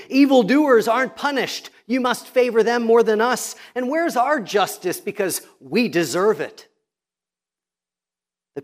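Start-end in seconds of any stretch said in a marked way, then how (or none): 0.1-6.7 whiny complaining people. Retold or imitated. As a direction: whine it like an entitled crowd demanding their due. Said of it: "the people are complaining, saying".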